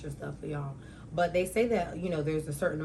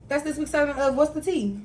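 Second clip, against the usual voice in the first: higher, louder show announcement; segment sign-off; 9 semitones higher, 6 dB louder than usual. Real, not acted. Host closing a podcast segment, loud announcing. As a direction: sing out the segment name.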